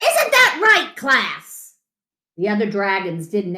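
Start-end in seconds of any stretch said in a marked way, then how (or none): 0.0-1.8 high, pushy coach turning to class; coach asking class. A narrator's reading. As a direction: demand agreement from the class. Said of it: "yelled the coach".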